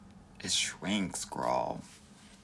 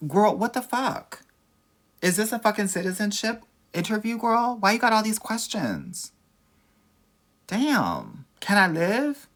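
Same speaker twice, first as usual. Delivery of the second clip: shrieking high, loud fake indignation; hammed-up exasperated outburst at a nosy question; mock outrage; 12 semitones higher, 6 dB louder than usual.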